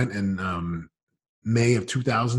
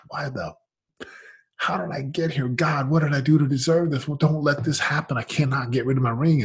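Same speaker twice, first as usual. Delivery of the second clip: higher, pleading, a touch of tears; retold crying plea; 6 semitones higher than usual. Man selling his Super Bowl ring, mildly tearful plea. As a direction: beg God, half in tears. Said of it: "I'm bawling my eyes out".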